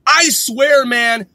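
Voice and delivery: usual speaking voice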